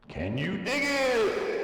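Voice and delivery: high, slow, drawn-out exclamation; excited. Real, not acted. To viewers, gleeful drawn-out high question. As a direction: stretch it up high with glee.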